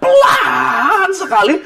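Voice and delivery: high, stretched-out first word; drawn-out emphasis. Retold or imitated. Preacher tells congregation of a crawling taxi driver, drawn-out emphasis. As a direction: drag the word out to show the crawl. Mimics how slow the driver was.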